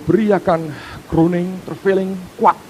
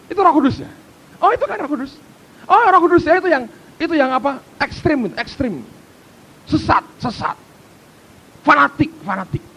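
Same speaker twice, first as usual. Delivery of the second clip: high, loud scornful mimicry; mocking scoffer; 9 semitones higher, 3 dB louder than usual. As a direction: spit out the labels like an accuser. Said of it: "mesti berkata".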